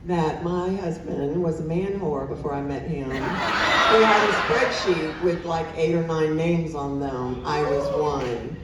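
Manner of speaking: normal talking voice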